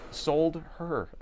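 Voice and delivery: "sold her" split into two words, stressing sold; "sold her" as two words, leaning on sold, a little creepy. Shown as a demonstration. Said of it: "If I say it like that, that is creepy".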